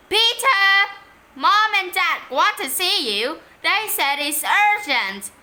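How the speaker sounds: high, loud urgent call. Acted. Fake urgent call to brother.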